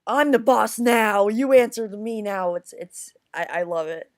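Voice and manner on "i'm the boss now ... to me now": mock boss voice claiming power; mock tough boss. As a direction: bark it like the new boss in charge. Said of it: "he's like".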